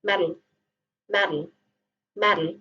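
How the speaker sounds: "metal" as "meddle", t tapped; low, quick-tapped "metal"; American flap. Shown as a demonstration. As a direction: flick the tongue for the t in "metal". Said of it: "Itu pun contoh flap".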